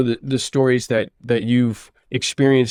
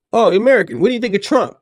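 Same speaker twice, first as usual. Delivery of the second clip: higher, louder mock eager question; Russian woman, imitated; 7 semitones higher, 4 dB louder, faster than usual. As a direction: play her pouncing with a high-pitched question. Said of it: "having a Russian woman".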